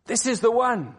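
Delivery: high excited announcement, slow; a hint of joyful shouting in the streets. Woman telling the town, a touch of excited shouting. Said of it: "shouting out".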